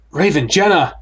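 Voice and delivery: calling names into the dark. Acted. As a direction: call out to friends ahead. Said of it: "I call out into the darkness".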